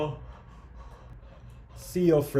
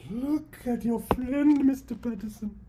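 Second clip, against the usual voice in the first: mock high taunt; 12 semitones higher than usual. Villain taunts.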